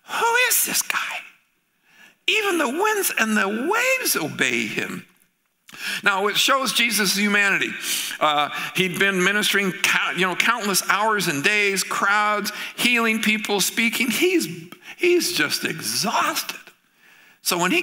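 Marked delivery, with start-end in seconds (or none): 0.0-5.0 high, breathless hushed awe; awed whisper. Acted. Terrified disciples marveling at Jesus, awed whisper. 14.1-16.6 weary drawl. A narrator's reading. Describing Jesus tired.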